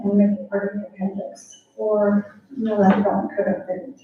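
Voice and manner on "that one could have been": normal talking voice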